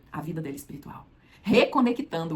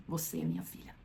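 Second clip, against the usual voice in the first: softly whispered, tender; 3 semitones lower, 12 dB quieter than usual. Acted. Jesus whispering to the healed woman that she is his daughter. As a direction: breathe it to her gently.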